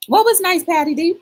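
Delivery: sing-song question. Acted. Playful call to viewer.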